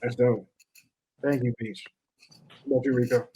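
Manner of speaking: ordinary talk, nothing marked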